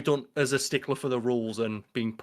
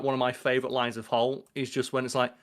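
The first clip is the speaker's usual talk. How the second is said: normal talking voice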